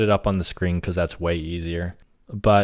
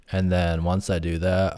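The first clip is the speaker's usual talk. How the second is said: ordinary delivery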